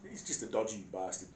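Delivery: ordinary talk, nothing marked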